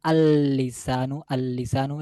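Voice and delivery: "আল-লিসানু" slow, with one long held l; lam merged into lam. Shown as a demonstration. Teacher showing the definite tongue, "আল-লিসানু" with lams merged. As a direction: dwell on the l in "আল-লিসানু". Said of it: "ওইটা পরের লামের সাথে মিশে".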